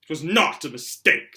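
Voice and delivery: loud, slow insistence; boy defying his uncle loudly; loud protest. Acted. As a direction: push back hard. Harry arguing back.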